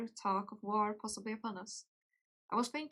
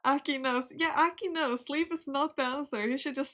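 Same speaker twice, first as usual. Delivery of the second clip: loud playful; 5 semitones higher, 7 dB louder than usual. Real, not acted. Playful chat reply.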